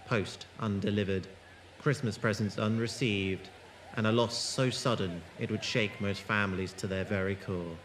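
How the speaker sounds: low, measured narration, eerie and unhurried; story narration. A narrator's reading. Narrator setting an ominous scene, in low measured story voice. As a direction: draw listeners into a creeping mystery. Narrator opens the audio drama.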